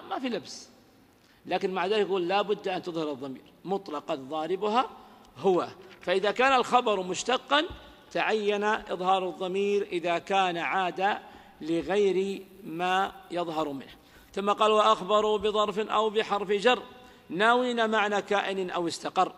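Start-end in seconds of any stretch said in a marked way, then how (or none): none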